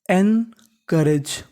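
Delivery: Encourage very slow, split as एन, then करेज; धीरे, टुकड़ों में उच्चारण. Shown as a demonstration. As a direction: break encourage into two parts with a clear pause after एन. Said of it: "तो meaning बनी". (Encourage को बहुत धीरे, एन और करेज में तोड़कर बोलें)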